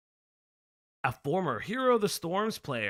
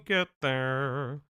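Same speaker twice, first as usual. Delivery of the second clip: ordinary delivery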